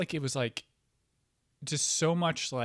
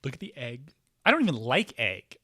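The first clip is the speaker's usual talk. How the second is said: ordinary delivery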